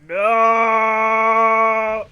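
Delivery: long, loud cry of dismay; anguished shout. Real, not acted. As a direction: wail it as the crash happens. Plane crashes with Kerbals aboard.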